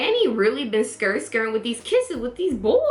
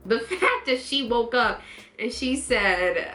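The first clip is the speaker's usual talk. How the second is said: usual speaking voice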